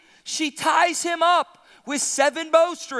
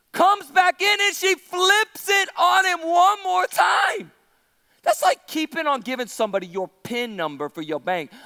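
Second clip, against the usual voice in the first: ordinary talk, nothing marked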